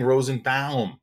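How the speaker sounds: "Rosenbaum" with a mouth-stretching OW; -baum as BOWM. Retold or imitated. Son refuses dad's version, "Rosenbaum" said with BOWM. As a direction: say "Rosenbaum" to rhyme with "town". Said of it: "I don't want my mouth to go".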